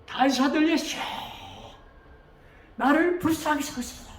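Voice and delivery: acted beggar's cry, pitched high. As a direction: call out from afar, begging for mercy. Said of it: "더 크게".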